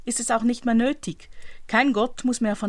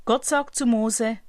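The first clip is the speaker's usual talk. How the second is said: ordinary talk, nothing marked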